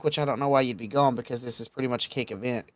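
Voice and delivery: ordinary delivery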